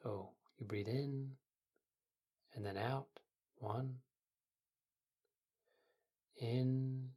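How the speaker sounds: soft, quiet meditation guide; sleep story narrator. Acted. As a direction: ease the listener into calm.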